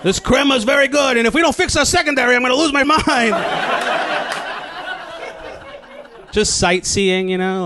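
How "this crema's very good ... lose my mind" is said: high, hammed-up Jersey tourist; mock sports-fan rant over espresso; Italian American abroad. Jersey fan in a piazza, hammed-up complaining. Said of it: "who act like that in Italy".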